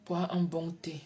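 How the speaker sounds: ordinary talk, nothing marked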